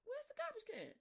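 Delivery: a mother asking where the can went; high-pitched mom looking for the garbage can; mama voice. Retold or imitated. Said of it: "Your mama like".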